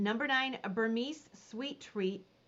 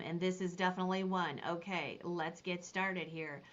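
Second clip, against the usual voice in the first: normal talking voice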